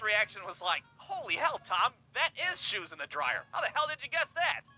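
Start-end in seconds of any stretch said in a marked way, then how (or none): none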